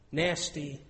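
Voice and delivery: normal talking voice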